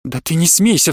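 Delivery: ordinary delivery, nothing marked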